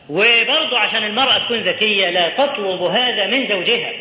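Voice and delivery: ordinary talk, nothing marked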